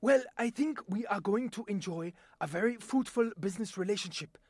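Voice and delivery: a Far East businessman closing a deal. Shown as a demonstration. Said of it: "my voice talent demonstration".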